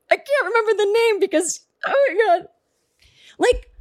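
high, frantic and flustered throughout; mock distraught caller. Caller too upset to recall the cabinet name, distraught. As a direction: fall apart over a simple question. And now I'm crying.

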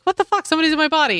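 high shocked mock outburst; startled, half-joking alarm. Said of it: "Spirit Lady Doctor Light is like".

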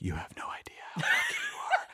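hushed, voiceless whisper to the dog. Retold or imitated. Owner whispering to the old dog being carried outside, whisper. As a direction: whisper it under your breath, laughter nearby. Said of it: "I'm whispering to her".

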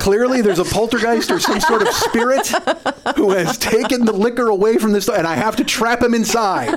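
high-pitched, laughing absurd reasoning; laughing mock logic. Real, not acted. Comic explaining the poltergeist who took the liquor, laughing. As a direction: argue the ridiculous theory while cracking up.

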